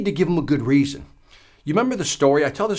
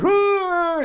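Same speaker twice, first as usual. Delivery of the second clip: high, louder howling moan; louder dog howl. Retold or imitated. Speaker showing the hound dog groaning louder in pain. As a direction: let the dog's groan swell up. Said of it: "it's getting louder".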